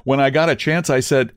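plain reading voice

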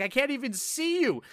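high exasperated shout; mock frustrated yell. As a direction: yell it at a glitched boss. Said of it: "And I'm like".

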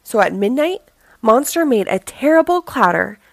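ordinary delivery

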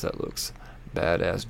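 half-whispered aside, reading to himself; reading under breath. Real, not acted. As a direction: mumble it like reading silently. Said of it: "I'm reading to myself".

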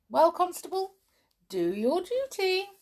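high, lilting formal order; commanding uncle. A narrator's reading. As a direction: hand over to the constable with relish. Uncle orders constable to arrest.

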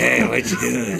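low, soft creep at the window; creepy voice. Acted. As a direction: lurk and leer, low and quiet. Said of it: "that's like the creep outside your window".

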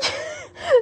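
high-pitched burst of laughter; real laugh. Real, not acted. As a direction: laugh at your own performance. Laughs at own acted lament.

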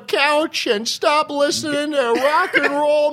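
high-pitched pep-talk voice; squeaky nagging coach. Retold or imitated. Coach voice telling kids off.

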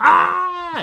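sudden high yelp of pain, loud; sharp imitated scream as he hits his head; kid's pained yell. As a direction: cry out like a hurt little boy. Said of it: "He's like".

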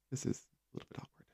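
whispered mock-awkward aside; barely audible, embarrassed murmur. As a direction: whisper it under your breath. Awkward aside in acted reaction.

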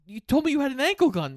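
high, fast indignant protest; indignant quote. Retold or imitated. Gosling protests to Crowe about the gun, fast and high. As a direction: blurt it out accusingly. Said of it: "he goes".

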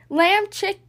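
ordinary delivery, nothing marked

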